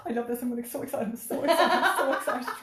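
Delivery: rising, laughing mock thrill; forced excitement. Mother talking herself into fun, laughing squeal. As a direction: squeal with nervous glee. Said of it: "So I'm like".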